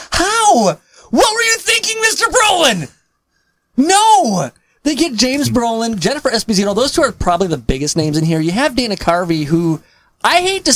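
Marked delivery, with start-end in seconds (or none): 0.1-4.5 high, incredulous mock scolding; hammed-up disbelief, pitch way up; mock outrage. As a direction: cry out as if confronting the absent actor. Mock scolding of absent actor.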